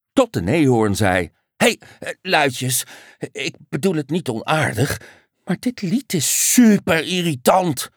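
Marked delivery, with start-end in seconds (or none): 1.6-7.9 hesitant start, then higher exasperated complaint; mildly irritated. A narrator's reading. Unicorn interrupting the annoying duet, mildly irritated. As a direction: start politely, land on lightly voiced irritation.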